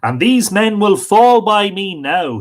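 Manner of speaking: loud, high, boasting king; the King of France loudly threatens Finn's men; boastful loud threat. Acted. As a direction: throw down the challenge. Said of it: "he said".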